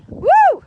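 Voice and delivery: loud high whoop; joyful whoop. Real, not acted. Joyful cry.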